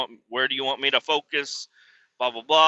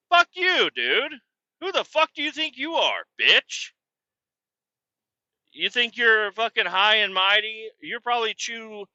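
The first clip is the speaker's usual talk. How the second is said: angry yell; 5 semitones higher than usual. Acted. Cursing out the guy who turned away, furious yell. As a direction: yell it at him in disgust.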